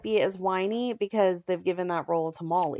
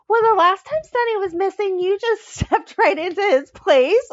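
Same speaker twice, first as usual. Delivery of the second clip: higher, louder imitation of a pointed dig; mock audacious accusation; 8 semitones higher, 5 dB louder than usual. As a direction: toss out the accusation high, laugh creeping in. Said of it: "she has the audacity to say".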